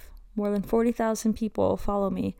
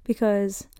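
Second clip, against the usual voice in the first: ordinary talk, nothing marked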